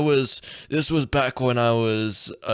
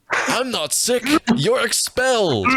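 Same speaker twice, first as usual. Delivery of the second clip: mock principal; 11 semitones higher than usual. Principal voiced in story.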